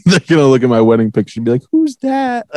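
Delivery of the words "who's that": put-on kid voice. Kids looking at wedding picture.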